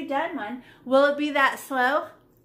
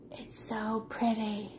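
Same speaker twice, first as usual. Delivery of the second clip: slow, hushed whisper of wonder; awed whisper; 3 semitones lower, 7 dB quieter, slower than usual. Real, not acted. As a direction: breathe it out in awe. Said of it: "You can't see the line anymore".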